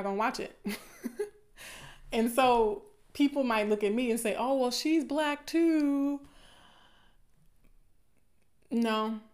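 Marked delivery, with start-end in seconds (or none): 4.3-6.2 higher, drawn-out imitation of an objector; mock objection. Imitating people who push back on her point, mocking. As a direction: put on a slow, high-pitched protest. Voices objectors' predictable comeback.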